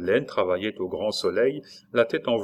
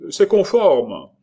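loud, high, slow proclamation; official call-out; 8 semitones higher, 11 dB louder, slower than usual. A narrator's reading. Brigadier calling that the papers are in order, loud official call. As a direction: shout it out officially, stretched and high.

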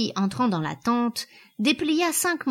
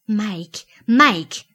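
warning call, much louder the second time; threatening; 7 dB louder than usual. A narrator's reading. Woman warning her man, threatening. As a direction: say the name as a mounting threat. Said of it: "menaça-t-elle".

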